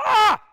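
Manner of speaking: sudden high piercing shriek at cold water; loud shocked cry; startled shriek. Retold or imitated. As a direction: yelp as a toe hits icy water. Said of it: "Boy, she hollered".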